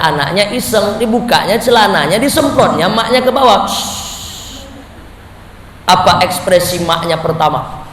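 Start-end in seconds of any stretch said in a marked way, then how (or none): 3.7-4.7 soft unvoiced shhh of a spray; breathy spray sound effect; spraying hiss. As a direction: hiss like a little stream squirting. Child spraying pee sound.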